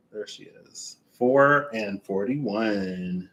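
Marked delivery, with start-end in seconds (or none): 0.1-1.0 whisper. Real, not acted. Whispers to the card.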